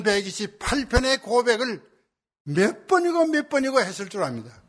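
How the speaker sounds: high, sustained sermon cadence; fervent preaching. Real, not acted. Pastor telling the congregation how earnestly she prayed, fervent preaching. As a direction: press each phrase home with rising fervour.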